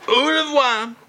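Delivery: hammed-up farewell. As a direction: fling out au revoir theatrically. Repeated farewell.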